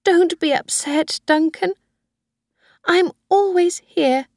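put-on a high old woman pleading with her son; old Mrs Harvey. Old woman voiced by reader.

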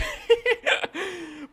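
high burst of laughter; laughing. Real, not acted. Player amused by being carried off in the game, high laughter. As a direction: crack up in a high-pitched laugh.

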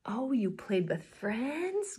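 usual speaking voice

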